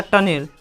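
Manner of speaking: टनेल as "tun-ell"; wrong reading टनेल, ending "-nel"; mispronunciation demo. Shown as a demonstration. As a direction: say टनेल with a clear "e" before l. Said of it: "टनेल पढ़ेगा तो गलती हो जाएगा".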